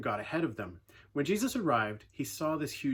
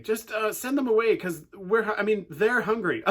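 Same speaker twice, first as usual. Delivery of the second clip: higher and louder, pleading and whining; whiny plea; 8 semitones higher, 7 dB louder than usual. Retold or imitated. Disciples whining to Jesus to send the crowd away, higher and louder. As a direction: beg like a hungry kid caught out. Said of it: "a whiny way of saying".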